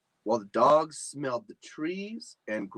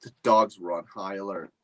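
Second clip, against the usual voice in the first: plain reading voice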